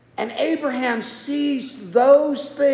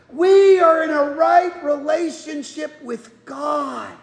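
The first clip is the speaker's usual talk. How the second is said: fervent pulpit shout; preaching at full force; 3 semitones higher than usual. Acted. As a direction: proclaim God's promise to the back row. Preaching to the congregation.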